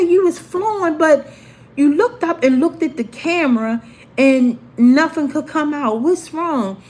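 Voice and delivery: retold teacher's high, insistent questioning.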